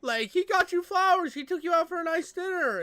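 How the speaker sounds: loud, high-pitched indignation; outraged rant. Real, not acted. Host scolding her over the flowers, outraged yell. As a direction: yell it in disbelief.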